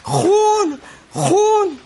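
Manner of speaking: high shouted cry; madman's shout. Retold or imitated.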